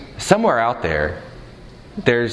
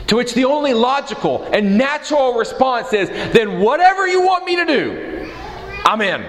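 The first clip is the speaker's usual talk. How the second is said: preaching at full force; 12 semitones higher than usual. Acted. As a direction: lift the whole room, shouting.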